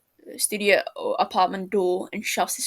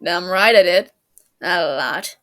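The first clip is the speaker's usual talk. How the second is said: smirking; 2 semitones lower, 7 dB louder than usual. A narrator's reading. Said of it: "James smirks over his shoulder".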